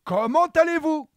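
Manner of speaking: high, loud clown greeting; silly clown. Acted. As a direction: call out like a goofy clown.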